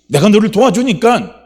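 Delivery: high, loud impassioned promise; urgent assurance. Acted. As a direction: press the promise home at full force. God repeats it, urging.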